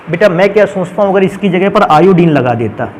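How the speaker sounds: ordinary talk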